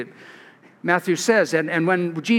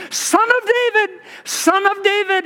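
much higher pleading call; crying out; 11 semitones higher than usual. Retold or imitated. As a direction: cry out to be heard over the crowd. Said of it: "he cried out".

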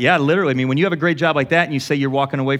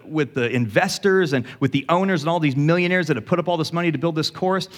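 usual speaking voice

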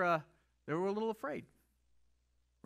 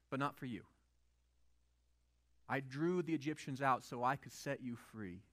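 calm God voice; 8 semitones lower, 4 dB quieter than usual. Pretend. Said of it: "He said".

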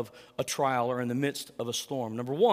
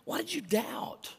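tender disappointment; 7 semitones higher, 4 dB quieter than usual. Acted. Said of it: "It's almost like Jesus is saying".

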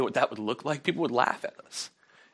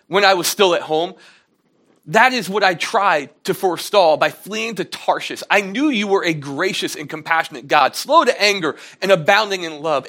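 loud, higher, indignant pleading; angry Jonah; 3 semitones higher, 6 dB louder than usual. Retold or imitated. Jonah protests loudly to God about mercy. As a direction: rant at God like a sulking prophet. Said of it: "So Jonah's angry now".

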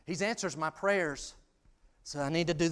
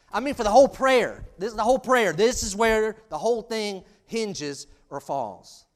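loud, raised preaching voice; forceful preaching; 6 semitones higher, 10 dB louder than usual. Real, not acted. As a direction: drive the main point home from the pulpit. Preaches the main point forcefully.

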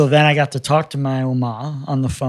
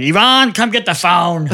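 loud, higher call across the house; 5 semitones higher, 4 dB louder than usual. Retold or imitated. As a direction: shout for his wife to come. Husband calls wife to phone.

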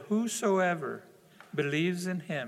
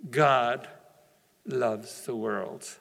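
slow emphasis; 6 semitones lower, much slower than usual. Acted. Summing up God's love.

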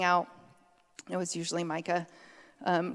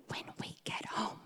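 whispered threat; 13 dB quieter than usual. Retold or imitated. Parent whispering a warning to the misbehaving son. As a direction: breathe it low and threatening. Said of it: "and we said".

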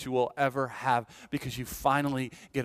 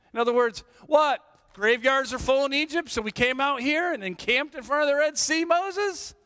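higher, louder sarcastic whine; complaining people; 9 semitones higher, 3 dB louder than usual. Acted. Israelites griping at Moses, in a high mock whine.

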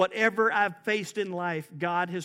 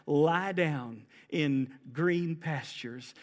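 very slow, lower, drawn out; slow and restful; 4 semitones lower, much slower than usual. Shown as a demonstration. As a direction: let each word lie down. Echoing restful verse.

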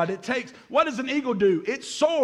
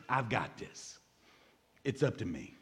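low, soft self-reliant murmur; voicing the lie; 10 semitones lower, 11 dB quieter than usual. Preacher quotes the lie "I've got this", low and quiet. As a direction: say it low, as if to yourself. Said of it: "The lie is".

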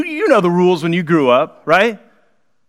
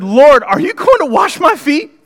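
alarmed Peter; 9 semitones higher, 4 dB louder, slower than usual. Acted. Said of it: "Simon Peter said".

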